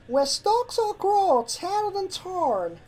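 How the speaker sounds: slow, high sing-song verse; reciting. Acted. Apple Bloom continues reciting the rhyme, slow and high. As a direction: intone the rhyme like a charm. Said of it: "Apple Bloom recited".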